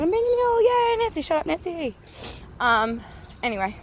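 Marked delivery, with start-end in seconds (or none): 0.0-1.9 silly high voice. Pretend. Mocking herself as a mule.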